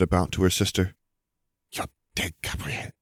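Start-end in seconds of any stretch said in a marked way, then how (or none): 1.7-2.9 soft, near-whispered menace; hushed threat. A narrator's reading. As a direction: threaten your sister under your breath. Sister threatens her teasing sister.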